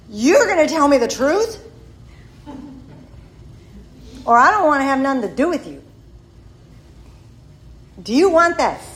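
imitating a demanding sponsor, loud and high; forceful retold ultimatum with long pauses; stern ultimatum. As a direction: lay down the law, punching the key words.

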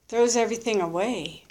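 normal talking voice

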